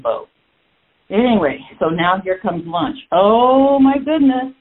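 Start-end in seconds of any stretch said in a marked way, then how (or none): 3.1-4.5 playful alarm at the prospect; lightly hammed-up dismay; mock dismay. Dreading lunch with new people.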